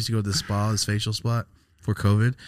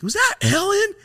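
very high and louder, starstruck squeal; excited mock yell; 7 dB louder than usual. Telling friend about seeing a celebrity, high excited squeal. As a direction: shriek it like spotting a celebrity. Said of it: "And I was like".

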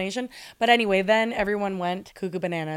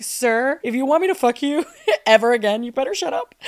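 sassy retort; 5 semitones higher, 6 dB louder than usual. Retold or imitated. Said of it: "I would literally be like".

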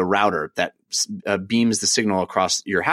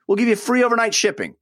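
excited; 10 semitones higher, faster than usual. Real, not acted. Ad read enthusiasm.

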